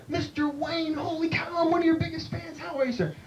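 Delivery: high, gushing starstruck fan. Retold or imitated. Bartender gushing at John Wayne, high and starstruck. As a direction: fawn over a movie star. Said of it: "I'm like".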